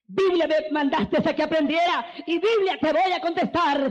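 high, loud, building to a shout; preaching shout. Acted. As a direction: proclaim scripture triumphantly. Predicador clama respuesta bíblica. (proclamación a gritos, cada vez más alta)

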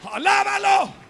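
loud, high shouted refrain over music; full-force revival cry; fiery preaching. Acted. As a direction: pound each line like a hammer. Quoting Jeremiah, escalating.

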